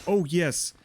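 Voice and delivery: high, loud burst of joy; excited yell. Real, not acted. Gamer cheering after beating the fight, excited high shout. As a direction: cheer out the win.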